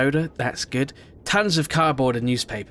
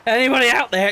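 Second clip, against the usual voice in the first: high, loud mocking call; mock shouting; 10 semitones higher, 6 dB louder than usual. Player mimicking the game character who gives him away, mock shout. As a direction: imitate an idiot calling out, loud and high. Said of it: "He's just shouting".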